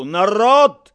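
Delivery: high, loud public proclamation; addressing the crowd. A narrator's reading. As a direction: project to a whole square. Addressing a crowd with raised hand.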